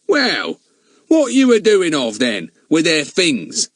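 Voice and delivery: loud, high Cockney shouting. A narrator's reading. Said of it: "shouted Fatty".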